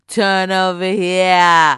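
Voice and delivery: badly faked Baltimore accent, belted out; Baltimore accent try. Player tries the wizard's Baltimore accent on a dare, loud and badly faked. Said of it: "Try it out".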